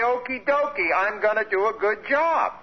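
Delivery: high, chirpy okie-dokie; mock eager acceptance. Acting out willing acceptance of affliction.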